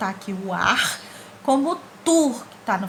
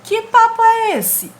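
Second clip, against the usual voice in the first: much higher, louder outraged question; indignant question; 13 semitones higher, 8 dB louder, slower than usual. Retold or imitated. Teacher re-quoting the comic's outraged question. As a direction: fire it off like a shocked cartoon kid. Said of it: "quando ele diz assim".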